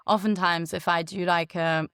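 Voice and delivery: normal talking voice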